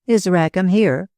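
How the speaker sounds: ordinary delivery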